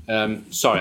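ordinary talk, nothing marked

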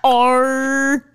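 high, loud, drawn-out Kiwi "oh, no"; New Zealand accent. Pretend. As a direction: wail it like a Kiwi. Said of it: "I'm a Kiwi".

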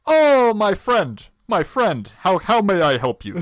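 put-on high, loud, slow-starting Middle Eastern accent. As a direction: play the eager helper in a Middle Eastern accent. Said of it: "I am gonna do the accent here".

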